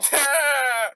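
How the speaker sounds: laugh. Real, not acted. Laughing at the diss.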